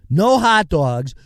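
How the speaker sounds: ordinary talk, nothing marked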